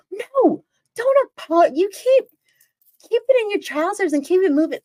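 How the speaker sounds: high-pitched, rushed mock scolding; indignant cut-off. Woman cutting off the apologizing stranger, acted indignant scolding. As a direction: refuse the apology and send him packing.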